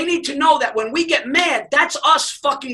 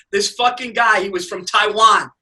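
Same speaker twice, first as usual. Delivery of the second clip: normal talking voice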